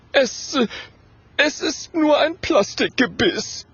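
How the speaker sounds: high, faltering confession; nervous little ghost, high-voiced; trembling with fear. A narrator's reading. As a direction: stammer it out timidly. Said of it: "Gerd bibbert vor Angst".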